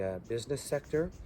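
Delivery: ordinary talk, nothing marked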